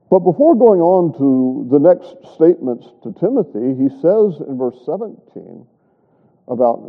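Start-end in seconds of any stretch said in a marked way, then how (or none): none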